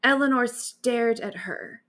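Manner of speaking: ordinary delivery, nothing marked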